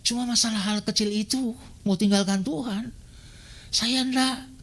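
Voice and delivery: ordinary talk, nothing marked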